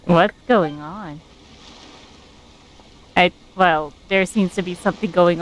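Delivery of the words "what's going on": usual speaking voice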